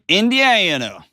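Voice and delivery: Indiana drawn out high in a West Texas twang; West Texas accent. Acted. Host naming the caller's state in a West Texas accent. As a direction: say Indiana like a West Texas cowboy, falling slowly. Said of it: "West Texas accent in Fort Wayne".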